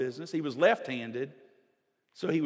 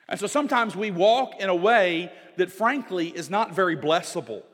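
louder, higher pulpit preaching; forceful preaching; 6 semitones higher, 8 dB louder than usual. Acted. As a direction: drive the point home to the church. Preaching builds again.

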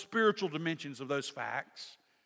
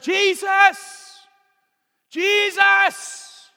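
much higher, much louder calling out; desperate shout; 15 dB louder, much slower than usual. Retold or imitated. Blind Bartimaeus shouting for Jesus, desperate yell. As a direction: yell across a crowd to be noticed. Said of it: "And so he cries out".

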